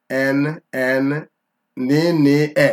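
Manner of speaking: nne broken into N, N, ni, ni, e; spelling out nne. Shown as a demonstration. Teacher breaks the Igbo word down for a student, slow and syllable by syllable. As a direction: sound out nne piece by piece, each syllable separate. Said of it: "So if I write nne".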